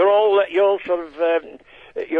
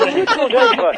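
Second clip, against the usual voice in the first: high comic catchphrase; 13 semitones higher, 5 dB louder than usual. Acted. Comedian's catchphrase line.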